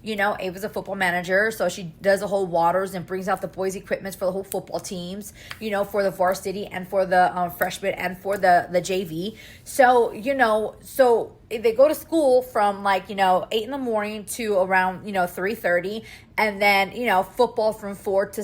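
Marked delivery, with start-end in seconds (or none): none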